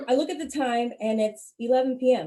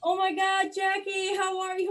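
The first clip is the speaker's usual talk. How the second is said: fake friendly greeting; 8 semitones higher than usual. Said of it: "people coming up to me like".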